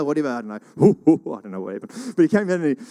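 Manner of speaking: ordinary delivery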